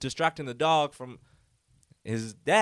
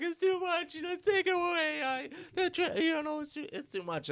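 high, wailing mock sob; imitating the aunt's hammed-up fake crying. Aunt pretending to cry at the mom, mock wailing very high. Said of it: "she starts fake crying".

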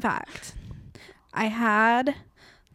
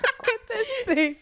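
laughing; 11 semitones higher than usual. Real, not acted. Still laughing at gift.

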